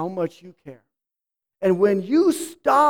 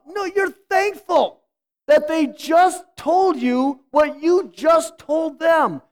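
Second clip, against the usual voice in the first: usual speaking voice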